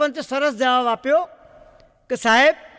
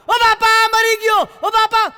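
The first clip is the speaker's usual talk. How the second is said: high, loud comic wail; mock yelling in pain; 7 semitones higher, 4 dB louder than usual. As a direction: howl like a pinched kid on a bike. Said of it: "હું જોરથી બૂમ પાડું".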